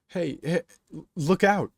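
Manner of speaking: loud, high stammered warning; yelling. A narrator's reading. Crowd as the train comes down the track, alarmed yell. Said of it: "Some people from the crowd yell".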